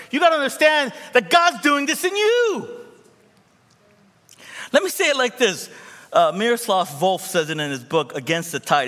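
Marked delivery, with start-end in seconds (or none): 0.1-5.7 high and loud, pressing; full-force preaching. Real, not acted. Preacher pressing the church, high and loud. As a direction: drive it home to the church.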